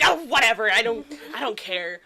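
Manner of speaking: mock brush-off, loud at first; dismissive dad. Dad shrugs off his tattling daughter, dismissive. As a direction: wave it off noisily, then settle. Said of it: "he's just like".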